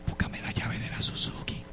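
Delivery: slow, hushed whisper; whispered request. Retold or imitated. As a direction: whisper it slowly, discreetly, mid-preaching. Pide la llave a la pastora mientras predica. (susurro lento y discreto)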